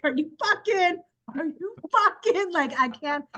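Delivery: high, incredulous sputter, breaking off; acted exasperation. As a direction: act at your wits' end, pitch jumping up. Imagined exasperation at obstinate official.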